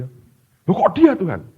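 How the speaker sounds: high, slow, stretched-out protest; a shocked man stretching out his objection; drawn-out, high-pitched disbelief; indignant surprise. Retold or imitated. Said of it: "Terus dia bilang".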